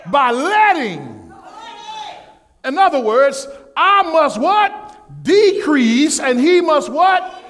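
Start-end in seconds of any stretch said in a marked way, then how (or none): 3.8-5.2 call to congregation. Real, not acted. Preacher's call for congregation response. 6.2-7.4 preaching call. Real, not acted. Preacher's call for congregation response.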